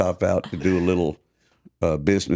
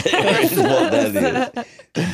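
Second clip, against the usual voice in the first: laughing; 3 dB louder, slower than usual. Real, not acted. Host laughs while praising couple.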